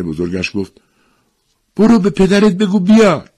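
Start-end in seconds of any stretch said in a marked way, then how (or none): 1.8-3.3 very high, loud command; mother urgently sending her son off; acted loud, pressing order.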